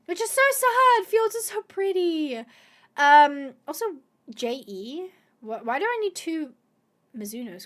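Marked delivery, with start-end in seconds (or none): 0.0-2.4 high, whiny mock lament; high, louder whiny so sad. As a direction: overdo the sadness. Fjords not working.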